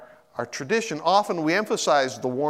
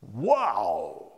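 hushed awe; 7 semitones lower, 4 dB quieter, much slower than usual. Retold or imitated. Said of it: "go like".

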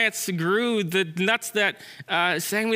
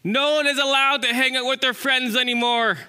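higher-pitched proclamation; announcing chipmunk; 5 semitones higher, 3 dB louder than usual. Acted. Chipmunks come out to announce a ban, proclaiming. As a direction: declare the ban in a raised voice.

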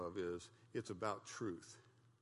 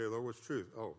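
ordinary talk

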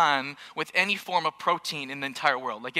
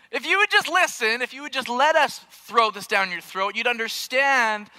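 mock exasperation; 8 semitones higher, 5 dB louder than usual. Mock exasperated parent plea.

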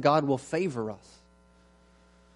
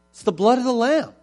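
louder and higher, driving the point home; emphatic preaching; 9 semitones higher, 8 dB louder than usual. Real, not acted. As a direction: proclaim it with full force. Preacher's climax.

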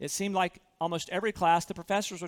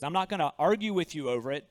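normal talking voice

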